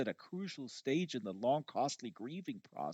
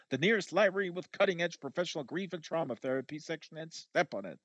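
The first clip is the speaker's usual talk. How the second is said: urgent cab order; 6 semitones higher, 4 dB louder than usual. Retold or imitated. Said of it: "I actually said".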